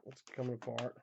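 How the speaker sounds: real failing voice, starting soft; worn-out voice, mildly struggling; voice giving out. Real, not acted. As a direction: let the voice come apart a little. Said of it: "My voice is coming apart".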